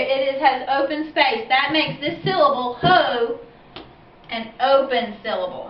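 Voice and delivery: ordinary talk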